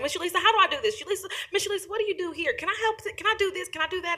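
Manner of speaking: put-on eager young client. Alana pestering her advocate with questions, high laughing mimicry. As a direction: mimic a bubbly kid firing questions through laughter. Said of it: "she's like".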